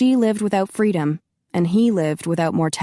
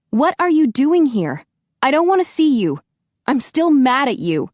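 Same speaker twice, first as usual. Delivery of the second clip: higher and louder, upset; heated rebuke to Grigori; angry; 8 semitones higher, 4 dB louder than usual. Acted. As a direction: tell him off, still mad. Heroine angry at intruder.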